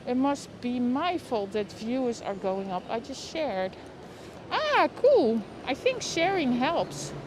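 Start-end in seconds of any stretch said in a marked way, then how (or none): none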